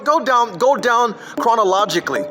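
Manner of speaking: ordinary talk, nothing marked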